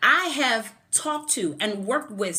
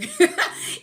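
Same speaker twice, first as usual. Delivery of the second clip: laugh; 4 dB louder than usual. Real, not acted. Laughing at her own point.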